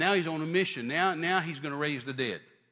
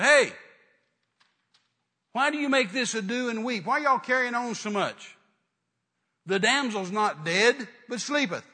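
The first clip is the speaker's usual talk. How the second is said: loud, high commanding challenge; preacher's raised-voice rebuke to mourners; acted rebuke; 6 semitones higher, 7 dB louder than usual. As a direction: cut through a noisy crowd with authority. Jesus confronts the wailing mourners.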